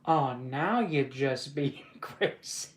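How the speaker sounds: slow, drawn-out line broken by laughter; stretched words giving way to a laugh; failing straight face. Acted. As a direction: crack up before the last word. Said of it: "I gotta stay with a straight face".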